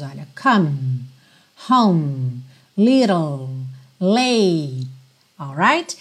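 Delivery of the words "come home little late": lower, isolated COME, HOME, LITTLE, LATE without final E; silent final E. Shown as a demonstration. Teacher lists words for students, silent E. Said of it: "vários E's que não são pronunciados".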